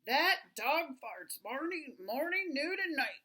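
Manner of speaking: loud, emphatic storybook father; father's complaint. A narrator's reading. Father complaining to the family about the dog, loud exasperation. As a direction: hammer out each word, loud and exasperated. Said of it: "said Father".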